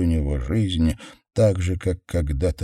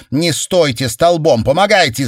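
loud, high-pitched order; rally them to help, shouting; brisk excited shout; urging command; 8 semitones higher, 8 dB louder than usual. A narrator's reading.

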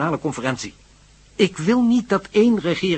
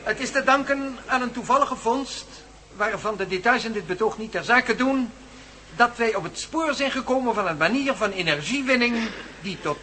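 higher, projected lecture voice; formal speech; 7 semitones higher than usual. Acted. Professor addressing the conference audience, formal and projected.

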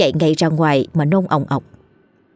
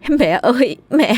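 hammed-up wail, rising higher; mock lament to call the village; fake wailing; 4 semitones higher than usual. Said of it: "kêu lên oai oái". (giả vờ gào khóc oai oái)

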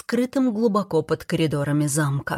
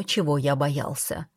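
plain reading voice

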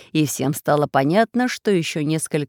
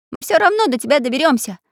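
put-on brave boy voice; 8 semitones higher, 5 dB louder, faster than usual. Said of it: "сказал отважный Чижик".

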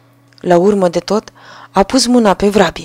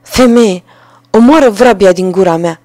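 urgent command; 6 dB louder than usual. A narrator's reading. Carter orders wife to strike.

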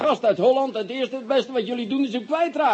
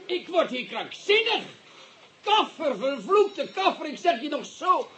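higher, slowed, fuming insults; exasperated outburst; 6 semitones higher than usual. Acted. Commissioner rages at his clumsy assistant, higher and slow. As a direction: vent your exasperation at the fool.

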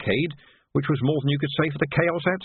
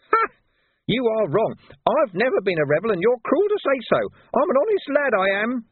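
high, wounded, near tears; tearful urchin; 11 semitones higher, 3 dB louder than usual. Acted. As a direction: plead hurt and blubbering. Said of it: "Big tears began to run".

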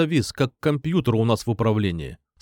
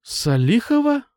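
puzzled frown; 11 semitones higher, 3 dB louder, slower than usual. A narrator's reading. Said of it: "нахмурившись".

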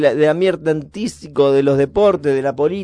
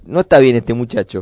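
ordinary talk, nothing marked

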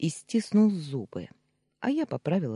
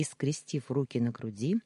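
plain reading voice

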